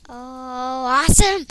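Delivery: thrilled "awesome!"; excited. Real, not acted. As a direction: squeal with joy. Excited gasp in game.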